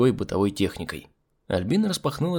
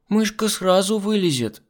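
put-on child voice; 10 semitones higher than usual. A narrator's reading. Child Miranda gives advice.

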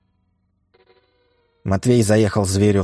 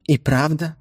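surprised mother; 9 semitones higher than usual. Acted.

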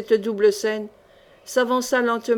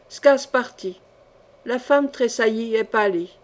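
usual speaking voice

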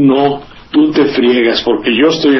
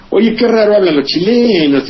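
quoting the Mexican; 6 semitones higher than usual. Retold or imitated. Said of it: "Y que le dice".

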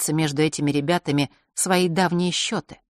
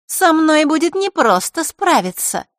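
put-on high, smiling, unbothered girl's voice; higher girlish self-assurance; unruffled girl; 11 semitones higher, 6 dB louder than usual. A narrator's reading. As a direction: say it with a confident smile. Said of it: "невозмутимо улыбнулась она".

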